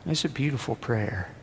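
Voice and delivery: ordinary talk, nothing marked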